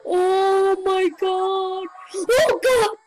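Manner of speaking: slow dread rising to a loud cry; frightened outburst, building; scared. Real, not acted. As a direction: draw it out, then yelp in fright. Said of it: "I'm actually scared of him".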